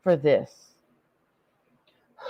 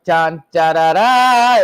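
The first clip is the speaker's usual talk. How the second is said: sung trumpet fanfare, rising louder; 2 semitones lower, 5 dB louder than usual. Retold or imitated. Mocking a grand entrance with a fanfare. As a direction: toot it like a herald's trumpets. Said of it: "the motherfucking herald".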